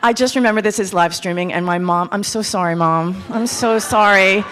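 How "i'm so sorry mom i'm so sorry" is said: normal talking voice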